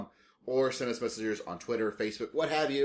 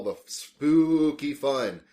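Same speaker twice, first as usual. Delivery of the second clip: slow, louder eerie voice; spooky voice; 4 semitones higher, 6 dB louder, much slower than usual. Pretend. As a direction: stretch it out ghoulishly. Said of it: "I really like making this spooky voice".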